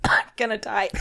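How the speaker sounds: gasping it out through laughter; helpless giggle around the words; laughing. Real, not acted. Laughing to the partner after choking on a sound.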